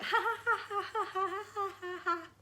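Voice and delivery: high-pitched mock "ha ha ha"; hammed-up sneaky laugh meant to needle; snide fake laugh. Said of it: "that's on the snide".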